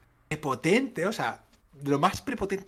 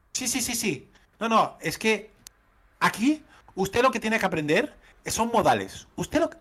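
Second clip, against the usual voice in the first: imitating a haughty official, pitched much higher; tono prepotente; 8 semitones higher than usual. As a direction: lecture him like a smug clerk teaching manners. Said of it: "me lo decía con un tono potente". (imitando a una funcionaria prepotente, con voz mucho más aguda)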